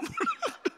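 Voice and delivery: a sudden high-pitched burst of laughter; shrieking little laugh. Real, not acted. Laugh alone.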